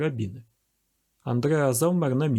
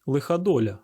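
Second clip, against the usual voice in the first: plain reading voice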